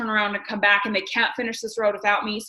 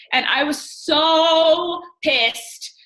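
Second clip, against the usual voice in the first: "so" dragged out long and high; slow, drawn-out "so pissed"; stress on so; 5 semitones higher, much slower than usual. Real, not acted. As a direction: lean hard into "so", very slow. Stretched angry emphasis on so.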